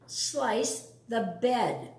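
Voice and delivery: usual speaking voice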